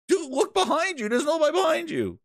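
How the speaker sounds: high, ringing disbelief; incredulous. Real, not acted. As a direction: call it out incredulously. Incredulous mocking.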